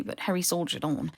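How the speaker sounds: ordinary delivery, nothing marked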